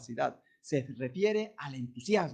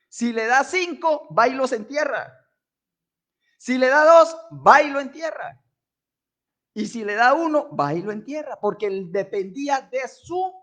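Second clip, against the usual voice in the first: louder, higher preaching cry; fervent preaching; 6 semitones higher, 7 dB louder than usual. Acted. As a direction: drive the point home to the church. Preaching the talents parable loudly. (predicación fervorosa, más fuerte)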